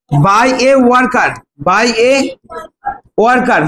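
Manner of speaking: slow, word by word dictation. Shown as a demonstration. Teacher dictating question ten, very slow and deliberate. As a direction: spell it out slowly for the class to write.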